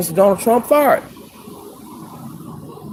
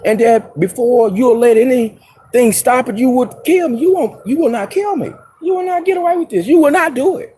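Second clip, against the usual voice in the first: much higher, rushing defiant warning; defiant outburst; 7 semitones higher than usual. Real, not acted. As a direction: throw it back at them, unbowed. Defiant warning to corrupt officials.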